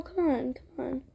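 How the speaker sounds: plain reading voice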